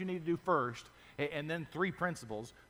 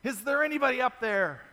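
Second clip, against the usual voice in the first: higher, louder desperate call upward; a man on a branch shouting to the sky; crying out for help; 9 semitones higher, 8 dB louder than usual. Retold or imitated. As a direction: yell it while hanging off a cliff. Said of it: "he cries out".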